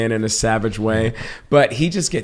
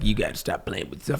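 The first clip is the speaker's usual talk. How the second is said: quiet fatherly hint; 3 semitones lower, 9 dB quieter than usual. Retold or imitated. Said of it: "he was just like".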